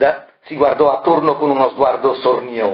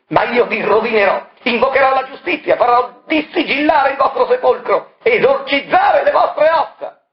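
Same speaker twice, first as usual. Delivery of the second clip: furious threat; 13 semitones higher than usual. Acted. Marella threatening Variconi, high furious shouting. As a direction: threaten him with everything.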